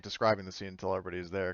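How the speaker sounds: normal talking voice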